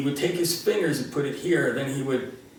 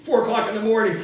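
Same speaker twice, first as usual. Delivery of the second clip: annoyed dad; 7 semitones higher, faster than usual. Retold or imitated. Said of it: "like my father was saying".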